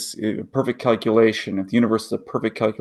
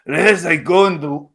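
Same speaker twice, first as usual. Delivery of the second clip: put-on higher, heavy Russian accent; 4 semitones higher than usual. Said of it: "Terrible Russian accent".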